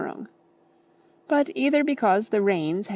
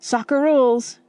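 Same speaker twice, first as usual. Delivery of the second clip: a quick, loud comeback; high, loud cheeky retort; 6 semitones higher, 6 dB louder than usual. A narrator's reading. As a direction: throw the rule back at him. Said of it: "George retorted".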